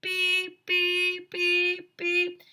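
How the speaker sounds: high, loud mock alarm beeps; high, even electronic beeps; imitated beeping. A narrator's reading. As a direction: beep like a smoke detector. Smoke detector keeps beeping.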